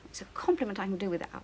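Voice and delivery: muttered under breath. Real, not acted. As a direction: grumble it almost to yourself. Bitter reply under breath.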